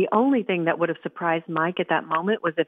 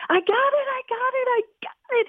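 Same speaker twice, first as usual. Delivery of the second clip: screamed. Acted. Boy screams over the football he just unwrapped, high and thrilled. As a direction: shriek it out like the best gift ever. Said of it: "he screamed".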